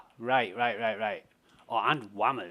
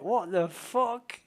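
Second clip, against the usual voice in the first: slow, higher, incredulous what the fuck; retold disbelief; 9 semitones higher, 5 dB louder, much slower than usual. Reacting to Bushy's advice, slow and incredulous. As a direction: drag it out in mock disbelief. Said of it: "I'm like".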